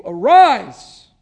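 loud ringing command; commanding call. A narrator's reading. Jesus commands the dead son, loud and ringing. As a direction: call the dead man up with power. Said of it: "and he said, 'Young man".

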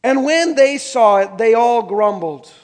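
loud, slow and deliberate Bible reading; scripture reading. Acted. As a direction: read the passage out to the congregation, slowly and loudly.

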